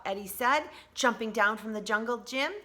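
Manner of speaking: ordinary delivery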